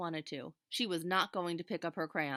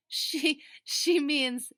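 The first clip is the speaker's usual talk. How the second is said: laughing; 9 semitones higher, 9 dB louder, much slower than usual. A narrator's reading. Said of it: "suddenly shouted with laughter".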